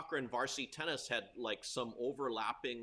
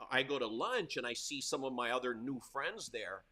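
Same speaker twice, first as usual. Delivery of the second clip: normal talking voice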